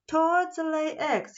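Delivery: announcing. Acted. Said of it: "he announced".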